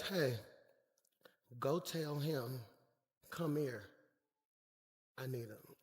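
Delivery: low, soft, slow voice of Jesus; calm and hushed, sending them gently; Jesus speaking softly. Acted. Jesus quietly telling his disciples to fetch the beggar, low and soft.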